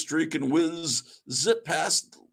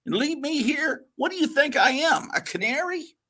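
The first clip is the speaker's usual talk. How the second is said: higher indignant little bird; 10 semitones higher than usual. Acted. Wiz the falcon protesting being left, high bird voice. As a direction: squawk it out, offended.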